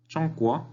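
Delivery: "Chongguo" high and level, then swooping up; first and rising tones. Shown as a demonstration. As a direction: pitch "Chong" high, let "guo" rise. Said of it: "Primer tono".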